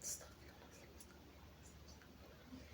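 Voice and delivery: breathy unvoiced plea to God; whispered prayer. Old woman alone after the call, whispered prayer. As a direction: murmur it to yourself.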